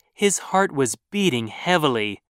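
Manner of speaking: ordinary delivery, nothing marked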